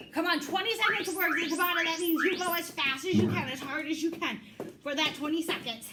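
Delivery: fast, pumped-up workout cueing; aerobics instructor. Acted. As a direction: hype the class through the sprint. Pumps up class during interval.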